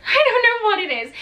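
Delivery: laughing at herself for forgetting. Real, not acted. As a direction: laugh it off, embarrassed.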